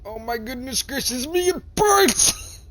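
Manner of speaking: high, rising to a loud squeal on burns; mock pained exclaim. Player reacts to the burning power, hammed-up prissy squeal. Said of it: "and I'm like".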